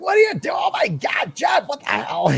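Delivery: high-pitched, hammed-up outburst; imitating someone aghast, voice leaping up; very excited interviewer. Goldie scolding Jeff for tossing stuff out, hammed-up excitement. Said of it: "a very excited version".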